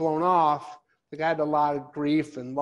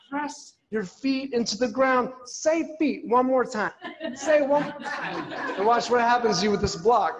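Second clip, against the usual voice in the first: yoga teacher; 6 semitones higher, 3 dB louder than usual. Retold or imitated. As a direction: coo it like an overly serene instructor. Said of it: "and she's like".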